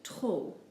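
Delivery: TROP ending on an open "oh"; silent final P. Shown as a demonstration. Teacher showing learners the first word, silent P. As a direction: say TROP without the P. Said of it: "We basically just ignore the P".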